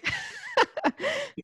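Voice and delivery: high, squeaky laughing fit; embarrassed laugh. Real, not acted. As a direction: burst out laughing after choking. Laughs after choking on drink. (risa nerviosa y aguda)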